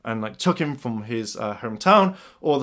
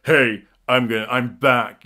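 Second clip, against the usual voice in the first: lightly imitated deep villain voice; Trent; slower than usual. Returning villain to the Rangers, imitated deep voice. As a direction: put on a hint of a deep voice. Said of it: "has that really deep voice".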